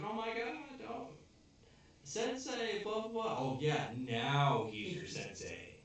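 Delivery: hammed-up schoolgirl gushing over her teacher; girl's voice. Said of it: "She's like".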